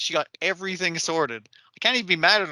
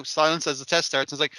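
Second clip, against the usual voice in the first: ordinary delivery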